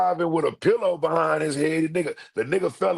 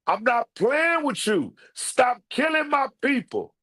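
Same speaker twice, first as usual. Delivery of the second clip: mock furious rant; 10 semitones higher than usual. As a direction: yell it out, fired up. Comedic threat to an enemy.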